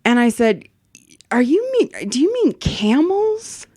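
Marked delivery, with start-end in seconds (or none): 1.3-3.7 much higher, fake-naive question; mock innocence. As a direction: play dumb, slowly and sweetly. Clerk feigns misunderstanding.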